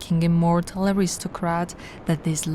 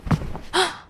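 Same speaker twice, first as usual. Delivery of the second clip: high, breathless startled gasp; sharp gasp; 12 semitones higher than usual. Acted. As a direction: gasp as if caught off guard.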